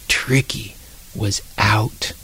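hushed whisper of a secret. A narrator's reading. Narrator whispers to listeners that the hamster escaped. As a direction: breathe it out like a secret.

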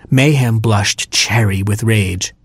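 plain reading voice

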